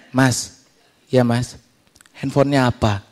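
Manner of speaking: lightly voiced seatmate, low and soft; nosy seatmate. Plane passenger asking his seatmate about his phone, low nosy voice. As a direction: drop low for a casual question.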